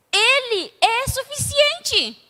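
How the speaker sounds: loud, slow and high, each word weighed; preaching emphasis. Acted. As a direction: proclaim it slowly. Preaching climax. (proclamação lenta e forte)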